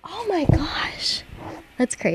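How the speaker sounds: slow, breathy whispered gasp; awed whisper. Real, not acted. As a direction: hush it out in awe. Sees emergency helicopter outside.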